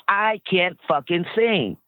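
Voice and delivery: ordinary delivery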